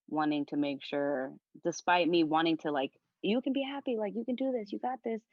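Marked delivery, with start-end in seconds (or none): 3.2-5.2 bright, high, sing-song hype, a bit over the top; teasing the toxic-positivity voice; mock cheery pep talk. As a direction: chirp it like a relentless cheerleader. Mocking the forced-positivity message.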